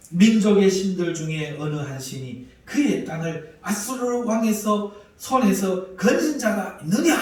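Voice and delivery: mock the gods of the nations out loud; loud taunt. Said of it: "이렇게 큰 소리죠".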